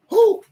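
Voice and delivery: sudden high yelp at hot water; startled whoo. Retold or imitated. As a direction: yelp like the bath just burned you. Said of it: "you be like".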